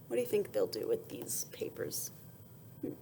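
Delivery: low hushed whisper, a little quick; whispered question. Acted. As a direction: whisper the question to draw them in.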